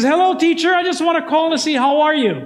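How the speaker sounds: high, bright mock-innocent child calling teacher; mock sweet kid voice. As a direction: play the cheeky kid sweetly greeting teacher. Kid phoning teacher at 3am.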